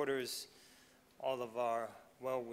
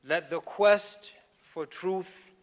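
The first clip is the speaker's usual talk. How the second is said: louder, higher preaching build, some words slowed; impassioned commencement exhortation, louder and higher; rising oratory; 5 semitones higher, 13 dB louder than usual. Acted. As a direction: build to a fervent climax exhorting the students.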